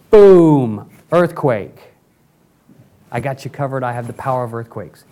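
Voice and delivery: mock-mighty God with a huge booming burst; God showing off his power. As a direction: explode on the boom, then settle to casual reassurance. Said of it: "time for him to say".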